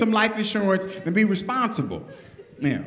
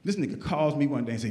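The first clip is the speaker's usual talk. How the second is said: ordinary talk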